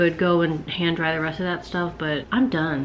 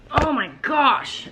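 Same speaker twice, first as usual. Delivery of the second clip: exasperated laugh; 10 semitones higher, slower than usual. Real, not acted. Dog returns vigorously.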